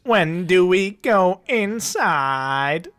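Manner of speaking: put-on slow, laughing robotic kid voice; a robot mimicking a child. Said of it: "It's like a robot".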